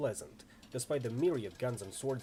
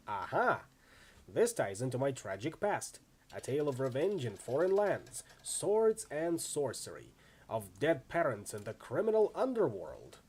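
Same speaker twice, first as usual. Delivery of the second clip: ordinary talk, nothing marked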